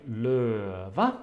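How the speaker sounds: LØVE falls on the first syllable, second syllable jumps up. Shown as a demonstration. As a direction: say løve dipping on LØ and rising on VE. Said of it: "the non-stressed syllable is higher".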